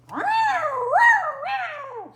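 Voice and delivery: very high, loud wailing yowl; Scarface McClaw yowl. Acted. Cat stuck in the tree yowls back at the dogs. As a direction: wail like an angry tomcat. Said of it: "said Scarface McClaw".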